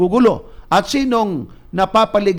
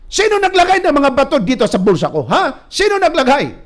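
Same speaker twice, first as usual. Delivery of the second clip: higher, louder indignant questioning; mock angry demand; 8 semitones higher, 4 dB louder than usual. Hungover man raging about stones in his pocket, higher and louder. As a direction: demand who filled your pockets with rocks.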